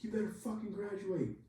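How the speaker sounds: very high-pitched mock tirade; imitated angry threat. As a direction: threaten them like a fed-up superior. Senior guy slams table, threatens.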